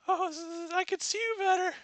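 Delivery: high, fast stammering granny; wolf grandma. Acted. Wolf grandma stammering an excuse, high granny voice. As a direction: trip over the words like a nervous granny.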